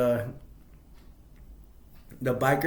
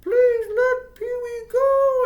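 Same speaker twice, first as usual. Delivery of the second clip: very high, slow squeaky begging; a childish squeal, drawn out and pleading; whiny Pee-wee plea; 8 dB louder, much slower than usual. Retold or imitated. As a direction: beg in a stretched-out high whine. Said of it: "I don't know why he starts like".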